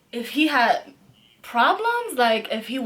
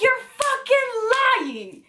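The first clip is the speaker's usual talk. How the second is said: retold yell; 12 semitones higher, slower than usual. Retold shouting match at 4am.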